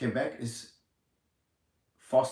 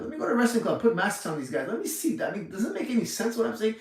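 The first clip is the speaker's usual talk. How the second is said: higher, sneering mock voice of a clueless official; mocking the know-it-all; 7 semitones higher, faster than usual. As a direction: lightly play the arrogant fool who never wrestled. Voicing the arrogant mask-maker.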